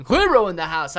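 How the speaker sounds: loud hype call to a viewer; hype shout. Real, not acted. Streamer hyping a viewer into the chat, loud. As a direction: announce the arrival like a hype man.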